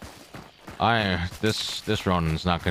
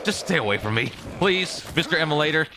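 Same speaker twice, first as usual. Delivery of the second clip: scared horror game streamer; 8 semitones higher than usual. Pretend. Begging the monster.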